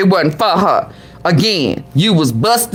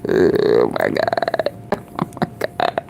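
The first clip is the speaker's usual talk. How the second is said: whispered disbelief; 6 dB quieter than usual. Real, not acted. Stunned reaction to the clip.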